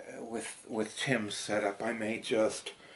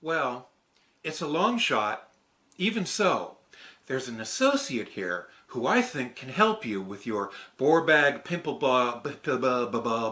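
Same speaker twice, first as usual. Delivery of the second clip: raised, louder character voice; character read; 10 dB louder than usual. Acted. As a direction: perform the script line in a bright character voice. Stevie puppet script read.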